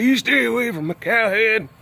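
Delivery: put-on high, loud old codger threat; guard it like a grumpy old hillbilly; old Pappy with shotgun. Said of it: "like old Pappy with her shotgun".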